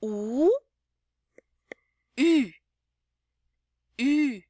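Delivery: U held round, repeated slowly; teacher repeating the French vowel for practice. Shown as a demonstration. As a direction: drill the rounded U. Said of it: "Então".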